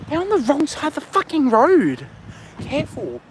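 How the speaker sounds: high-pitched, incredulous outburst; real exasperated disbelief. Real, not acted. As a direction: exclaim it high, appalled at the driver. Disbelief at wrong-side driver.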